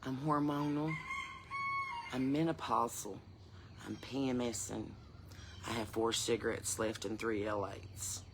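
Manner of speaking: low, slow and soft; understated menace, very quiet and drawn out; deadpan warning. Acted. Woman warns she is hormonal and menopausal, deadpan and quiet. Deadpan list of her own state.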